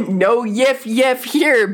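slow, drawn-out slogan voice; slogan delivery. Acted. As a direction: read it like a t-shirt slogan. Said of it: "That's the t-shirt".

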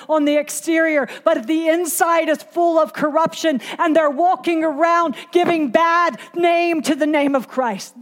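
high, driving sermon delivery; impassioned preacher hammering each phrase; full-force preaching. Real, not acted. Preaches against Pharisee leaven.

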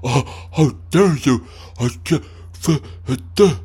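stammering, put-upon mock outrage; mocking offended voice. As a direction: mimic someone playing shocked. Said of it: "you're like".

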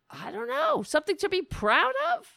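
very high, loud exasperated question; incredulous outrage. Real, not acted. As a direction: pitch leaps up in disbelief. Incredulous outrage at meat-eating pride.

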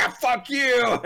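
high, loud mock holler; hammed-up shouted retort; retold angry yell. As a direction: imitate a man yelling across the street. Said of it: "the guy on the bench was like".

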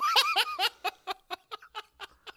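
helpless high-pitched laugh; a real laugh that breaks out; high burst of laughter. Real, not acted. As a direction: crack up at your own story. Cracking up at own story.

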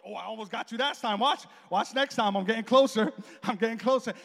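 loud, boastful mock warning; imitated taunting. Wife bragging after failed chokehold, imitated taunting. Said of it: "start talking".

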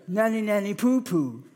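sing-song playground taunt; sulky Naaman taunting like a child; childish taunt. Retold or imitated.

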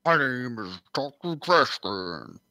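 a hint of a goofy talking trash can. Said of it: "what if you were a talking trash can?".

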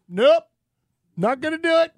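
high, loud mock protest; acted defiant refusal. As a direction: refuse emphatically, pitched right up. Acts out flat refusal to boss.

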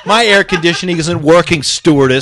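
loud, whiny passenger parody; hammed-up petty complaint; mock complaining. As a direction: gripe loudly like a spoiled flyer.

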